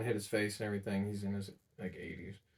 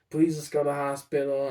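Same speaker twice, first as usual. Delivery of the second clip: raised, pleading ask; 4 semitones higher, 8 dB louder than usual. Retold or imitated. Grandson begging sick grandpa to go, raised plea. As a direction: beg him louder to come along.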